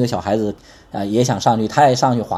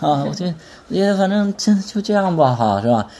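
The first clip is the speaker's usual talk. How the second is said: a limp, defeated sigh, pitched higher; mopey discouraging mumble; 5 semitones higher than usual. Retold or imitated. Mocking a gloomy believer who says just let it be. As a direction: sound like you've given up. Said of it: "你不要说".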